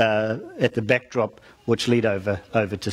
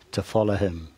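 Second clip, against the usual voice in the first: weight the words to follow him as the point; stress on follow him; 4 semitones lower, 3 dB quieter than usual. Real, not acted. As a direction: lean on follow him, said lower and softer. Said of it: "emphasis on the word to follow him".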